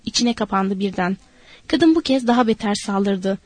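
usual speaking voice

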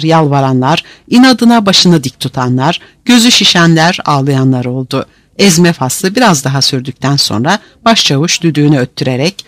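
plain reading voice

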